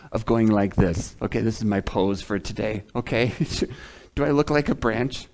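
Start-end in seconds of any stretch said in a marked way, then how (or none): none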